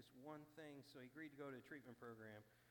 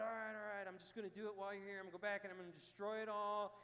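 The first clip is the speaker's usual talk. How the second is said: eager vow; 6 semitones higher, 8 dB louder, faster than usual. Retold or imitated. Drunk man vows to destroy bottles.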